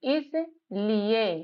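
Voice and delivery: इसलिए read very slowly, each syllable apart; slow sounding out. Shown as a demonstration. Teacher decoding इसलिए slowly from its spelling. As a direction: stretch इसलिए out for a learner. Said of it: "आईएसएएलआईई".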